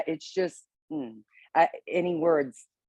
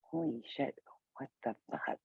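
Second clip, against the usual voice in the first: muted, half-whispered cursing; hushed swearing; 3 semitones lower, 15 dB quieter than usual. Retold or imitated. Swearing under the breath so people here don't hear. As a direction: keep the curse down so people nearby won't hear. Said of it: "I can't cuss real loud 'cause there are people here".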